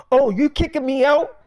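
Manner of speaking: retold offended friend; indignant disbelief. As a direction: ask it as if betrayed. Friend is told to leave.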